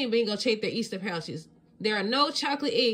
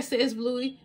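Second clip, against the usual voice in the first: plain reading voice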